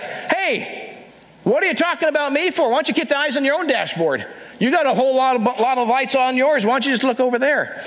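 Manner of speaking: mock-defensive huffy comeback; defensive retort. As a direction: bristle like someone caught out.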